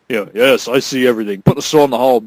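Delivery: low, quiet lazy pronouncement; mock bored god. Mocking Heimdall's lines, low and quiet. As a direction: drone it out lazily.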